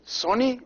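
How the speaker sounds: "soni" with a short O. Shown as a demonstration. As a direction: keep the O brief in "soni". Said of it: "with a short O".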